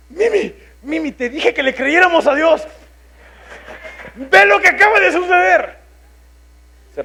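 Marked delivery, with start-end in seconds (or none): 0.1-5.8 high and loud, bursting with joy; excited exclamation. Retold or imitated. To his wife after the surprise offering, a high loud joyful exclamation. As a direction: call his wife's name, thrilled and rising. Retold joy reading the gift message.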